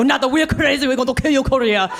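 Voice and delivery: usual speaking voice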